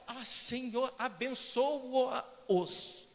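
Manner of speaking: drawn-out sanctimonious blessing; falsely pious prayer. Acted. Hypocrite praying for those they want to kill, falsely pious. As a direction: stretch the last word in mock holiness. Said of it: "falsamente, hipocritamente, você ora".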